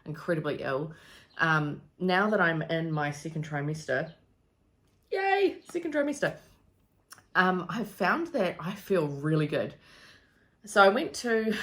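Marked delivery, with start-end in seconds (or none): 5.1-6.4 high, sing-song yay; playful high-pitched celebration; mock cheer. Real, not acted. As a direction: cheer it with a little self-aware grin. Celebrating second trimester.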